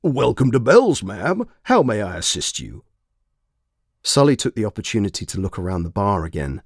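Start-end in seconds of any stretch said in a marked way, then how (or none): 0.0-2.8 bright, higher greeter voice; perky robotic barman greeting; cheery screen mascot. A narrator's reading. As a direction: chirp it like a cartoon dog host.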